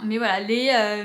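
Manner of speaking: ordinary talk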